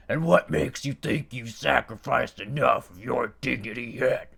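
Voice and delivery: loud gruff domineering demon. A narrator's reading. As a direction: put the servant in his place. Dullahan scolding Barnaby.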